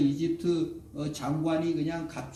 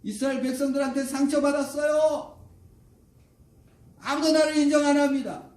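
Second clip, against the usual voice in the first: high-pitched wounded pleading, building loud; protesting refusal; 9 semitones higher, 4 dB louder than usual. Acted. Hurt Moses refuses God's call to return, mounting high protest. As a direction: wail out excuses in mounting protest.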